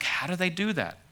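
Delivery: mock baffled admiration; puzzled amazement. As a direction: act out someone marvelling, pitch lifted. Voices an admiring church member's wonder.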